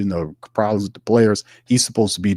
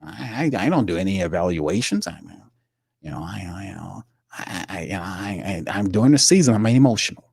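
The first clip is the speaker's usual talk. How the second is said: imitating Loomis. Host mocking Mickey Loomis at his press conference, hesitant mumbling. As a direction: stammer through it like a flustered GM. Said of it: "He told you that".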